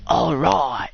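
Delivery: drawn-out pretend Australian vowels; hammed-up Australian accent; mock Australian accent. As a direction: have a joking go at Australian. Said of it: "I don't know how to speak Australian".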